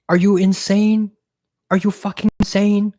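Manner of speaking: loud, high, appalled outburst; outraged disbelief. Real, not acted. Vlogger ranting about her host's tap water, loud and outraged. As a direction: shout it like she's lost her mind.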